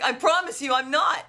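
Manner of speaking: high, insistent retold protest; mock-indignant patient, voice rising at the end; exasperated retort. Patient throws dentist's words back.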